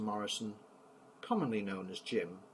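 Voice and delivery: ordinary delivery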